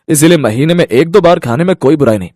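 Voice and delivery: ordinary delivery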